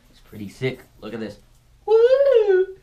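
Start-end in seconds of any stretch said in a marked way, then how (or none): 1.8-2.8 very high, loud "woo"; high-pitched whoop. Pretend. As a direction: let out a long, piercing toy-like whoop. Imitating the spaceship toy's sound.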